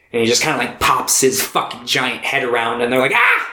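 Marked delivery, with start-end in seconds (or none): 3.2-3.5 short, very high yelp; high-pitched fake scream of surprise; mock startled yelp. Startled by giant head popping round, mock yelp.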